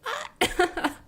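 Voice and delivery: high squealed giggle; delighted high laugh. Real, not acted. Fan reacting to a streamer's greeting, delighted squeal.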